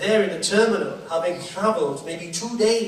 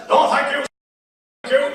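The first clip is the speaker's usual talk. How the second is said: loud, higher mock brush-off; overheard guy refusing; 8 semitones higher, 6 dB louder, faster than usual. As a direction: wave off the photographer firmly. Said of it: "I overheard one guy actually say this".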